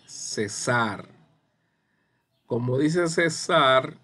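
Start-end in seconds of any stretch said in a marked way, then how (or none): 0.0-1.1 César said se-SAR, second syllable stressed; stress on last syllable. Shown as a demonstration. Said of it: "zar es la sílaba tónica". 3.1-4.0 César said se-SAR, louder on the second syllable; stress on last syllable. Shown as a demonstration. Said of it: "zar es la sílaba tónica".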